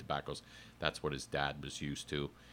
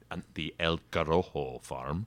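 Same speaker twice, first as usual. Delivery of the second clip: hammed-up Spanish accent on El Corojo; 4 dB louder than usual. Shown as a demonstration. Self-mockingly redoing the farm name, exaggerated Spanish accent. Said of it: "do it with a Spanish accent".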